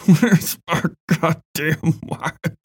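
high, slow, sobbing question; sobbing plea. A narrator's reading. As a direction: cry it out in pain. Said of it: "Ben asked, sobbing".

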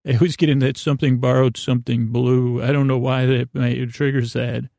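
usual speaking voice

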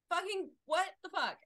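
high, loud what-the-fuck; annoyed driver swearing at the stopped car; exasperated outburst. Retold or imitated. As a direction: blurt it out in disbelief.

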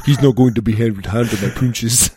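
fast, a light imitation of an Icelandic accent. Icelandic strongman mocked by a podcast host, a hint of an Icelandic accent. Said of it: "the Icelandic accent's weird".

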